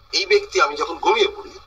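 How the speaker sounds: usual speaking voice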